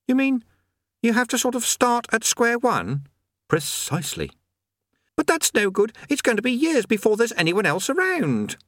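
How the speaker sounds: put-on high young boy's voice; lightly high-pitched youth voice; boy Eric. Eric dismayed.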